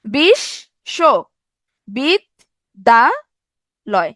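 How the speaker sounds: "বিশ্ববিদ্যালয়" in five widely spaced pieces, very slow; teacher re-demonstrating "বিশ্ববিদ্যালয়" with a long pause after every syllable. Shown as a demonstration. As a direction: say "বিশ্ববিদ্যালয়" as বিশ, শো, বিদ, দা, লয়, each held apart. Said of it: "আবার খেয়াল করেন, উচ্চারণ করেন".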